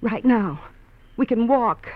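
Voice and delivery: wife urges husband away, loud and pleading; urgent pleading. Acted. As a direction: push him to leave right now. Sue pleads urgently with Dave.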